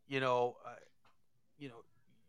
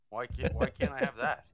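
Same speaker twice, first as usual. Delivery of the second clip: loud joking protest; 3 semitones higher, 11 dB louder than usual. Real, not acted. Joking demand for beer on air.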